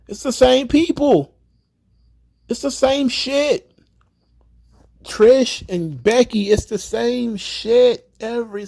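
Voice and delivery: high-pitched fed-up complaint; real frustration, voice pushed high; exasperated rant. Real, not acted. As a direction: vent at the bookers, pitch climbing. Ranting about repeated matches.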